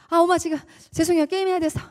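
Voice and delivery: hurried gamer son dodging dinner; impatient son. Retold or imitated. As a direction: rattle off an excuse without looking up. Son brushing off mother, gaming.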